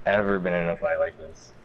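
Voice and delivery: ordinary talk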